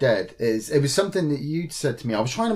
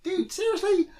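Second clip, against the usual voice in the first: much higher, whiny mock-innocent protest; mock hurt whine. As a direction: play wounded innocence, pitched way up. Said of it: "And I'm like".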